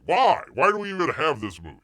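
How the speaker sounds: loud, fast exasperated why; fed up and asking it out loud. Real, not acted. Exasperated outburst.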